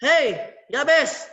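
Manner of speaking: a loud, slow, sharp snap; snapping. Shown as a demonstration. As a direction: bark it out like an angry scolding. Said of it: "Bentak Azizah".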